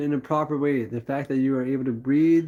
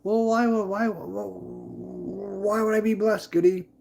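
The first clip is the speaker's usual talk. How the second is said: higher, doubting voice of a skeptic; doubtful viewer; 5 semitones higher, slower than usual. Retold or imitated. A viewer questioning Goody, in a higher doubtful voice. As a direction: ask it like a puzzled viewer. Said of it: "and you'd say".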